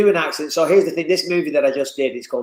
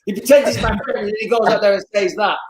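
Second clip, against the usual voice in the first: higher-pitched mock American fighter, indignant; American accent; 5 semitones higher than usual. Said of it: "that American accent that crept in there".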